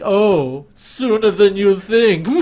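slow, high, loud sinister drawl; hammy villain voice. Retold or imitated. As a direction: ham up the smug villain line. Repeats villain line hammier.

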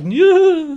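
high, loud wordless grunt of effort; mock straining noise. Host imitating Harvey fighting his saluting arm, mock strain. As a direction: squeal as if wrestling your own arm down. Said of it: "dragged his arm down".

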